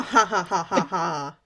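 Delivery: slow villain cackle; evil laugh. Pretend. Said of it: "Evil laugh with me".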